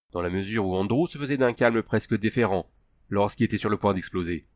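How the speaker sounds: ordinary delivery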